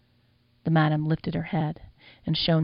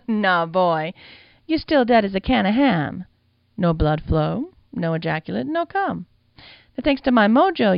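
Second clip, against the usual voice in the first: sassy madam; 3 semitones higher, 3 dB louder than usual. Acted. The madam explaining undeath to the boy, sassy and louder.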